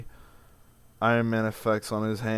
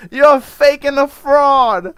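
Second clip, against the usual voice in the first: slow, much higher, much louder Italian American screech; Livia Soprano voice; 16 dB louder, much slower than usual. Retold or imitated. Mocking his half-sister in a shrieking Italian American accent. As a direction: wail it like an outraged Italian American mother. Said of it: "I said it with her accent".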